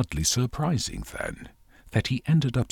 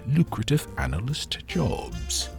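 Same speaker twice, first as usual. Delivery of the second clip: normal talking voice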